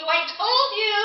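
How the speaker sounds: exasperated outburst. Acted. News anchor to Jane, loud exasperated I told you. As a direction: blurt it out, vindicated. Anchor scolding about history.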